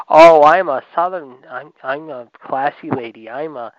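slow, mocking Southern belle voice, the first word loud. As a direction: sneer it out like a prim Southern lady.

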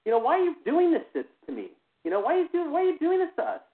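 high, loud and outraged; furious retold yell. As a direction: yell it up high at the one tormenting you. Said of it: "And I'm just like".

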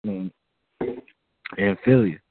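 ordinary talk

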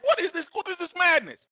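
high, appalled questions; retold disbelief. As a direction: recoil at the madness. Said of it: "I was like".

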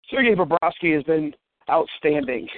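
ordinary talk, nothing marked

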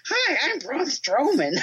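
high-pitched silly giant's voice; Braun Strowman. Retold or imitated. Fan imitates a podcaster's wrestler impression, high silly voice. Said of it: "he'll do the voice of Braun Strowman talking".

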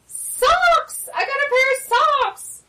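delighted high squeal of joy; excited. Real, not acted. Opening a gift.

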